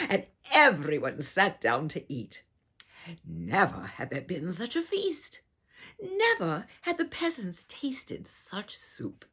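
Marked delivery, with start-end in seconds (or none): none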